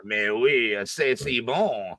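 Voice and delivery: slow, hammy French Mais oui, c'est si bon; French accent. Pretend. French villain joke, slow mock-French accent. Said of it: "evil French villain".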